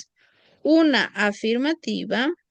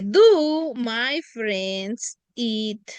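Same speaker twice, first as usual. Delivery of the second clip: slow question with a rise; deliberate classroom question; slow model question; much slower than usual. Shown as a demonstration.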